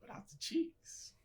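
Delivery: high, slow stunned echo; shocked disbelief. Real, not acted. Guest shocked the host skips cheese, stunned. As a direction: repeat it in disbelief.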